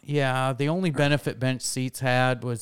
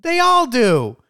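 high, loud outburst; real raised-voice retort; loud insistence; 10 semitones higher, 8 dB louder than usual. Real, not acted. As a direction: insist at the top of your voice. Loud playful dispute over teeth.

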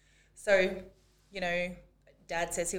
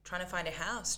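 ordinary delivery

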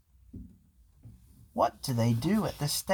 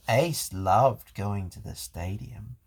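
ordinary delivery

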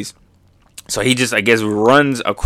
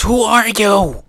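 high, drawn-out exasperated demand; hammed-up snapping; Kaido snapping; 10 semitones higher, slower than usual. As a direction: burst out in a put-on voice. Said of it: "Kaido snaps".

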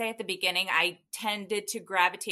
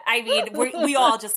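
ordinary talk

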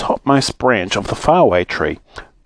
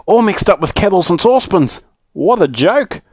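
higher, gleeful exclaiming; delighted; 8 semitones higher than usual. Acted. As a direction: relish the mishap, pitched up. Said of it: "said Moonface in delight".

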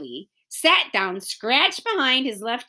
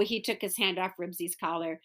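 ordinary delivery

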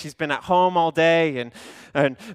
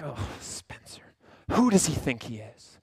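muttered grumble; 5 semitones lower, 7 dB quieter than usual. Retold or imitated. Pastor's retold inner grumbling.

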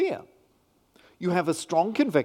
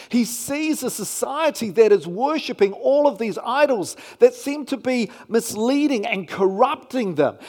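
ordinary talk, nothing marked

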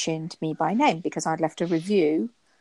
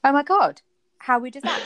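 surprised; 8 semitones higher, 5 dB louder than usual. Retold or imitated. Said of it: "And I'm like".

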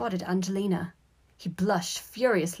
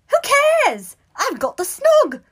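loud, high squeal, drawn out; overjoyed; 12 dB louder than usual. A narrator's reading. Boy boasting to his brother, overjoyed. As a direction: brush it off and crow with glee.

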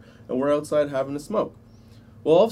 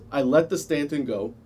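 ordinary talk

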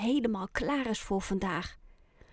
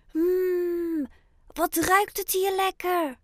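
higher, greedy little penguin voice; a hungry bird savouring the smell; penguin Oscar sniffing; 8 semitones higher, slower than usual. A narrator's reading. Penguin Oscar smells fish by the window, lightly voiced and greedy. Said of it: "zegt Oscar".

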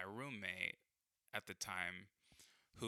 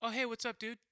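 very high, breezy mock hello; fake casual greeting; 4 dB louder than usual. Mimicking the roommate's innocent hello in the hallway, high and breezy. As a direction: chirp it innocently, mocking.

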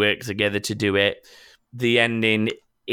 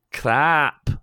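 attempted Scottish accent, pitched up; Fat Bastard; 4 semitones higher, 3 dB louder than usual. Reading a listener's request in a put-on Scottish accent. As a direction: try a higher Scottish character voice. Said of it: "in the accent of fat bastard".

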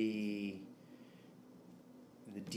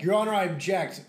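louder, higher lawyer voice; mock courtroom objection; 7 semitones higher, 7 dB louder than usual. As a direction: play a dramatic trial lawyer. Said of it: "be like".

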